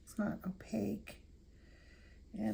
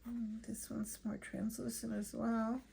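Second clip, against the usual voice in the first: whispering; 7 dB quieter than usual. Real, not acted. Murmurs while painting.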